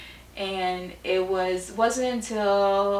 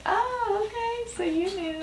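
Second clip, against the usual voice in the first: dawning realization; 12 semitones higher, 6 dB quieter than usual. Retold or imitated. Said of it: "I'm like".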